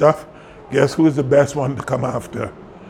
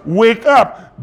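higher, forceful wake-up call; emphatic command; 7 semitones higher, 4 dB louder than usual. Acted. Preaches it as Jesus's command.

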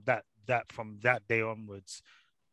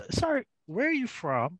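imitating the waitress's confused question, much higher and laughing; a baffled waitress, played for laughs through a laugh; puzzled waitress; 6 dB louder than usual. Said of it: "she just stopped me and said".

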